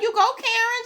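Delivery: very high, loud cheer. Real, not acted. Vlogger cheering Karen on screen, high excited whoop. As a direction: whoop her on like a fan.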